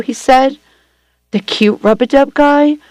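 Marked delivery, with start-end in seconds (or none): 1.3-2.8 high, amazed disbelief; surprised. A narrator's reading. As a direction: gasp it out in disbelief. Learns who the caller is.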